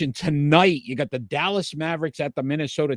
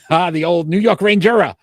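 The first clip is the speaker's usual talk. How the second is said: loud, laughing mock-fond exclamation; laugh, then belt out the silly nickname; amused laughing; 3 semitones higher, 7 dB louder than usual. Real, not acted. Host riffing on co-host's Rangera nickname, laughing.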